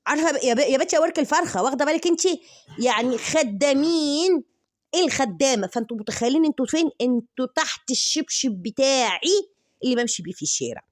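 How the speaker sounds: loud, high scolding; scornful tirade. Acted. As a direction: rip into her from above. (توبيخ بصوت عالي ومرتفع)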